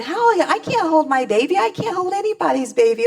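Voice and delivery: high, loud rising panic; panicked thought. Retold or imitated. New mother panicking about holding her baby, high alarmed. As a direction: spiral upward in alarm. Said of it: "I'm thinking".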